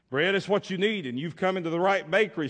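loud, high, fast boasting baker; pompous baker. Acted. Pastor playing a smug baker to the beggar, loud and high. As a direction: sell it like a proud know-it-all.

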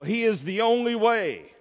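normal talking voice

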